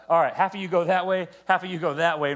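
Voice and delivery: loud commanding orders; bossy command. Acted. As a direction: bark out orders like a general. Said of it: "I actually think I'm doing this".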